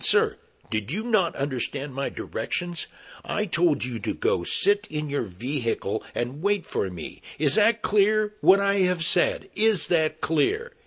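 stern commanding officer, rising in pitch and force; cop voice. Retold or imitated. Actor repeating his orders to hairy man, stern cop voice. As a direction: build to a forceful police demand. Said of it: "I just used my cop voice".

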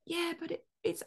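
put-on high, soft Australian accent; bad Australian accent. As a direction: imitate a sleepy Australian, badly. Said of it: "apologize for the bad accent".

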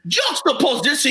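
"Juxtaposition" said high in a Cajun lilt; Cajun-accented "Juxtaposition"; Cajun pronunciation. Retold or imitated. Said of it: "the Cajun version of the word".

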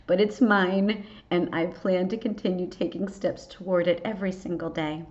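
ordinary talk

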